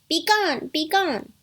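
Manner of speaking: put-on high, loud little kids' cry; defiant dismissal. A narrator's reading. Kids send the beastly wolf away, defiant. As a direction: shoo the wolf off sharply.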